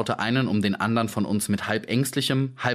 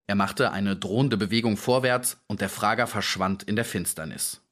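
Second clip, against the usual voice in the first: usual speaking voice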